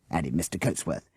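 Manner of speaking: ordinary delivery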